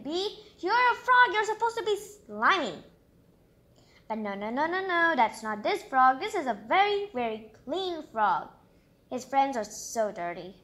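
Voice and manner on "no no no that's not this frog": ordinary delivery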